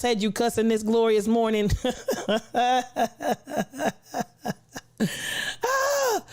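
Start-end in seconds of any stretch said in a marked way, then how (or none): 1.8-3.9 long staccato chuckle; laugh. Real, not acted. Streamer tickled by a near slip, laughing. 5.0-6.2 high squealed "ah" after laughing; laughing sigh. Real, not acted. Streamer recovering from laughing fit, tickled sigh.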